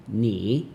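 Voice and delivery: "ni" drawn out, dipping then rising; teacher tone. Shown as a demonstration. Teacher breaking down ni hao, "ni" dipping then rising. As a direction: scoop "ni" down and back up. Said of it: "which is a teacher tone".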